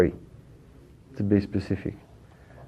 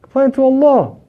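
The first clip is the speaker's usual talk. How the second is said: higher, louder fervent urging; pious people's exhortation; 7 semitones higher, 4 dB louder than usual. Retold or imitated. Quoting the pious to the congregation, raised fervent urging. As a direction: press them to take it to Allah. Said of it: "The pious people, they say".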